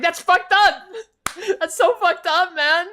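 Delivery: high, loud yell of disbelief; loud indignant holler; outraged shout. Retold or imitated. Acts out outraged reaction to friend.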